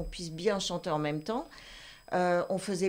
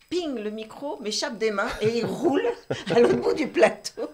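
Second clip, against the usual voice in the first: laughing; 3 semitones higher, 6 dB louder than usual. Real, not acted. Laughing while telling mishap.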